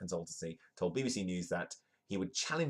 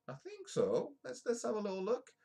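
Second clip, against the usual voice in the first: much higher, playful cartoon voice; squeaky put-on voice; 14 semitones higher than usual. Vlogger teasing the audience in a squeaky cartoon voice. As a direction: pipe it up like a cartoon character.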